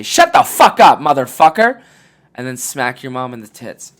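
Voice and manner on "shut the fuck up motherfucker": loud, much higher shout; loud, high telling-off of a father; mock yell. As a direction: scream it at your dad. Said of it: "look your dad in the eye and say".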